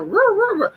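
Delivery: high mock puppy yips; playful very high woo sounds; imitating puppy noises. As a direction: yip like a bouncing pup. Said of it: "she jumping on me".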